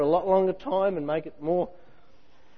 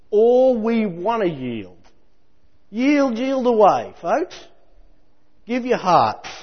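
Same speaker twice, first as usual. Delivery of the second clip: ironic urging; 6 semitones higher, 4 dB louder, slower than usual. Real, not acted.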